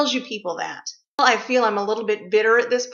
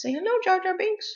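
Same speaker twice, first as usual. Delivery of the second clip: coaxing pet; 8 semitones higher, 4 dB quieter than usual. Real, not acted.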